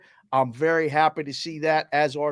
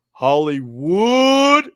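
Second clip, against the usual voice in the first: Hollywood estirado muy despacio y más fuerte, subiendo al final; drawn-out Hollywood; 9 dB louder, much slower than usual. Retold or imitated. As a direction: stretch Hollywood out long, slow and loud. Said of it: "He said it just like that in the chat".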